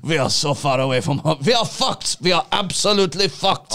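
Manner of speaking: lightly put-on German accent; a hint of German as a panicked bee. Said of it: "you're hearing it's German accents now".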